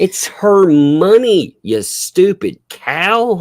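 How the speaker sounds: high, drawn-out scolding; slow, high-pitched indignant insult; exasperated outburst. Real, not acted. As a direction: stretch each word in loud, high exasperation. Exasperated outburst at absent woman.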